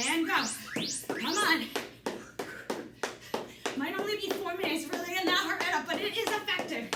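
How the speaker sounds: energetic workout cues; aerobics instructor. Acted. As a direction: drive the class on. Coach cueing round three.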